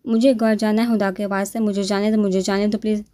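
usual speaking voice

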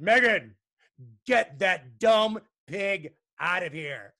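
loud, slow, word-by-word order; scolding teacher. A narrator's reading. Teacher finds a pig in the desk, loud slow scolding. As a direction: bark it slowly, each word separate.

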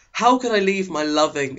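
ordinary delivery

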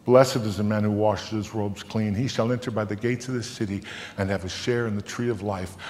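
plain reading voice

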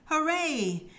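high, drawn-out hooray; a high storybook cheer. A narrator's reading. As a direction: cheer like excited children in a story. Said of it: "cheered Peppa and her friends".

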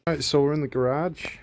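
slipped into an American accent. Real, not acted. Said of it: "Why did I say it like I'm American?".

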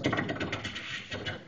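soft, voiceless tuk-tuk keyboard taps; imitated typing clicks. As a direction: tap out quiet mouth clicks. Imitates cop typing on laptop.

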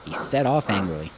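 plain reading voice

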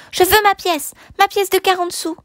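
put-on high, wailing little-boy demand; tearful child. A narrator's reading. Child demanding his forty sous back, tearful. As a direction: insist high, as a child close to tears. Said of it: "L'enfant pleurait".

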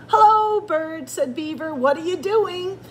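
high singsong hello; cheery greeting. A narrator's reading. Beaver hails Bird walking past, cheery.

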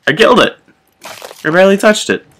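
high, loud amazed exclaim; surprised excitement. Real, not acted. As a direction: blurt it out, amazed. Surprised at kill.

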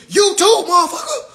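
high, loud comeback; heated mock confrontation; defiant retort. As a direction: fire it back loudly at a challenger. Acts out retort to protesting man.

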